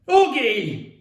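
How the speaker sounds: loud, high call of reproach; yelled. A narrator's reading. Mum cutting off her son's outburst, yelling. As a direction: yell his name to stop him. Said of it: "Mum yelled".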